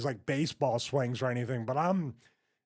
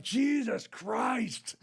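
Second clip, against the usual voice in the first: high, drawn-out mock holler; imitating a wrestler's pained disbelief; retold exasperated cry; 8 semitones higher, much slower than usual. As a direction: draw out both words, pitch way up. Repeats wrestler's backstage reaction.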